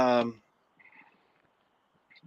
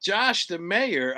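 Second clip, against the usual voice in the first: very slow, drawn-out proclamation; announcer call; 6 semitones higher, much slower than usual. Acted. Co-host proclaiming a donor's name, slow and grand. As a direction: stretch the name like a herald. Reading out super chat donor.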